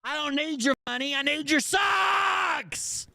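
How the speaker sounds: high-pitched pleading shriek, last word stretched out; mock desperate yell. Heckler mocking the streamer's money troubles, high mock shriek. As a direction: squeal it like a hammed-up plea. Commentator mockingly acts out a plea.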